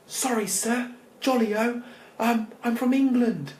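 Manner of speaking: high, loud, exaggerated posh English; poshest English accent. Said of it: "put my poshest English accent".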